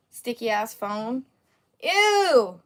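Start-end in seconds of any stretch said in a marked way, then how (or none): 1.8-2.6 high, loud squealed ew; loud high yelp of disgust. Real, not acted. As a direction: recoil with a shrill ew. Disgust at sticky phone.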